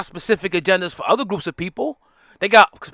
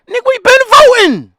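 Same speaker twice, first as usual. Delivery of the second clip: much higher, loud indignant shout; furious outburst; 9 dB louder than usual. Real, not acted. Answering a voting lecture with a furious high shout. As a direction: blast it out, fed up.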